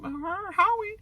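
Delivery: high, soft mock scoff; small high dismissive voice; twisted-lip disdain. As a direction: imitate a twisted-lip, doubtful question. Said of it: "you did the twisted lip".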